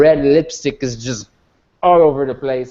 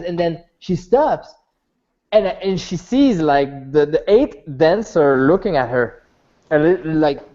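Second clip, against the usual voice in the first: usual speaking voice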